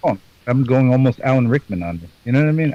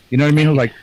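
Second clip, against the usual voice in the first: normal talking voice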